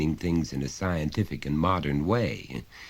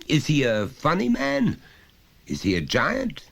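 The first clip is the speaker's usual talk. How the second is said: high, loud, lecturing father voice; a father talking down to his son, patiently insistent; stern father; 12 semitones higher, 5 dB louder than usual. Acted. Father pressing his small son, loud and high.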